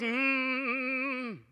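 soft closed-mouth moaned prayer; hummed moan prayer. Retold or imitated. Aunt moaning her prayer, soft hum. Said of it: "She was moaning her prayer".

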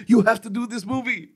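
imitated German accent, pitched very high. Director urging me to make the film, high German accent. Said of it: "that kinda German accent".

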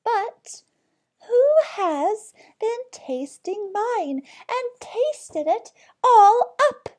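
very high, slow little bear; tiny bear's high, drawn-out wail; teeny tiny voice. A narrator's reading. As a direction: squeak it loudly as the tiniest bear. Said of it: "in a teeny tiny voice".

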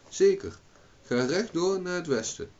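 put-on high-pitched boy, emphatic first word; helpful boy. A narrator's reading. Boy sends Owen west, high helpful boy. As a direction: answer high and sure, as a boy.

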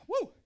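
short high woo of delight; joyful whoop. Real, not acted. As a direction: let out a happy whoop. Preacher whoops after shouting.

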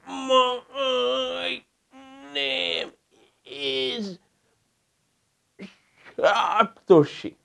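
straining stammer on Ma, high and soft; stammering demo. Shown as a demonstration. Teacher demonstrating a Stramonium stammer to the class. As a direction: force out each syllable with effort.

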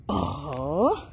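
ordinary talk, nothing marked

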